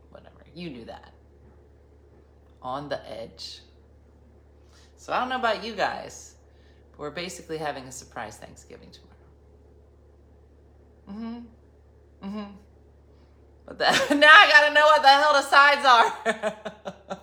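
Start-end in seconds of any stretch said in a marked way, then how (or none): 0.1-1.1 whispered aside. Real, not acted. 13.7-17.2 laughing. Real, not acted. Laughing at her own reversal.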